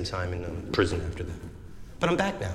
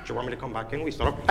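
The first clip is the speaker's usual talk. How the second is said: ordinary talk, nothing marked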